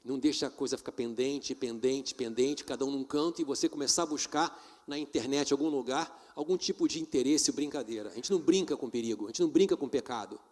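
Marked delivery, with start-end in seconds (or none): none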